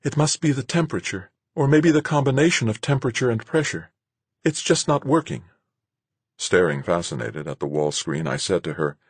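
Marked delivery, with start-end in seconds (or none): 0.0-5.5 put-on high, troubled young woman's voice; Marguerite, dismayed. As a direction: puzzle over failure with worry. Marguerite's character voice.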